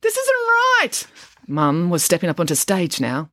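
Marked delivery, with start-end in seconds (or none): 0.0-1.1 desperate cry. Acted. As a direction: cry out in alarm. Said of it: "cried Dad".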